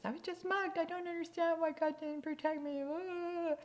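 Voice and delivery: very high mock wail of a woman in her nineties; hammed-up old lady complaint; upset old lady. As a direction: play the livid old lady, wailing up high. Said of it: "she's in her nineties".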